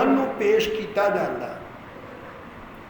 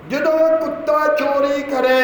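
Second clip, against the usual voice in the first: ਜਦੋਂ ਕੁੱਤਾ ਚੋਰੀ ਕਰੇ called out higher, with joy; loud and joyful; 6 semitones higher, 3 dB louder than usual. Shown as a demonstration. Preacher leads the congregation's repeat, loud and joyful. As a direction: lift the line up like good news. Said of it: "ਹੁਣ ਬੋਲੋ ਜ਼ੋਰ ਨਾਲ, ਖੁਸ਼ੀ ਨਾਲ ਬੋਲੋ".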